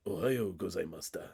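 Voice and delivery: low, gravelly Japanese greeting. Retold or imitated. Imitating the film's detective saying good morning, low and gravelly. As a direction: put on a deep, rough detective's voice. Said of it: "like, gravelly and stuff".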